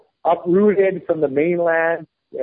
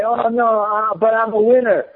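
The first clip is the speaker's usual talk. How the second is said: higher, mock-wounded kid voice; hammed-up self-pity, higher; mocking whiny loser; 7 semitones higher than usual. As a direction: whine it like a spoiled kid who just lost. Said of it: "they fail at something and they're like".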